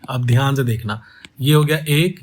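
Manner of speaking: normal talking voice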